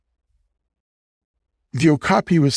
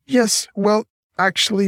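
sheepish backtrack; 11 semitones higher than usual. Acted. King backtracking, caught out.

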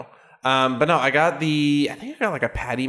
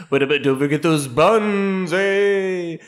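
ghost voice; 5 semitones higher than usual. Acted. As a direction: moan it like a spooky ghost. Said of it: "why did you sound like a ghost".

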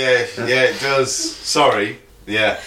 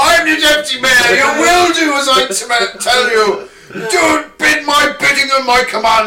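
loud, high, self-important decree from a mock tyrant; pompous deputy mayor; 14 semitones higher, 7 dB louder than usual. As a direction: shout it like a petty tin-pot ruler. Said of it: "Ben was running around with a knife".

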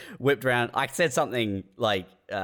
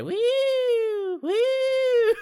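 long, very high "wee"; high-pitched drawn-out noise; imitated siren wail. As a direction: draw out a high, thin wail. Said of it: "just has a piss week".

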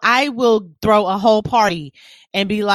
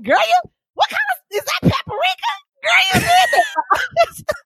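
much higher, shouted, stretched-out praise; over-the-top hype. Retold or imitated. Someone praising her cooking, high gushing shout. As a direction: gush over a sprinkle of paprika. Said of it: "Somebody be like".

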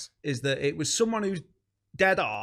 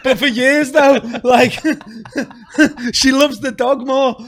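loud, higher, laughing through every line; laughing loudly; 7 semitones higher, 11 dB louder than usual. Real, not acted. Comedian laughing through a joke about his wife and the dog. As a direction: crack up while telling it.

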